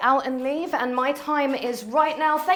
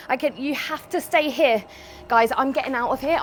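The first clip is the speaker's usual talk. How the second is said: ordinary talk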